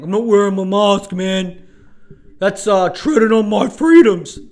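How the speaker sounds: hammed-up freedom rant; mock anti-masker. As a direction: lampoon the anti-mask bro. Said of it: "the other ones being like".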